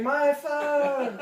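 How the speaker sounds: retold cry of dismay, held long; mock wail. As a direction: imitate a distressed call, stretching the words. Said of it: "floating down the river saying".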